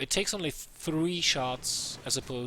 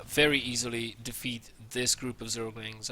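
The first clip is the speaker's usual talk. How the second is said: ordinary talk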